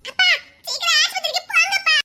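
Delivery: high, urgent call to father; urgent plea. Acted. Son begs father to rush to the hospital, high and urgent. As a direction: hurry him to the hospital.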